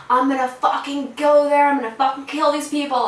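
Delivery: loud, high retold rant; brother's furious outburst. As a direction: imitate an enraged brother yelling threats. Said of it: "hear my brother in the back".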